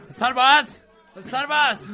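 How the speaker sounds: loud call across the field. Acted. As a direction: shout for the soldier. Calling for a soldier.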